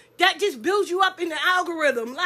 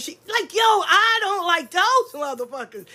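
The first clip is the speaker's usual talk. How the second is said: ordinary talk, nothing marked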